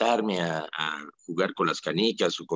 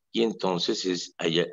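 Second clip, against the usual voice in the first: ordinary talk, nothing marked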